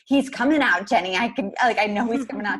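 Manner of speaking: retold labor urgency, high and loud; urgent insistence. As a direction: insist desperately that the baby is coming now. Insisting the baby is coming.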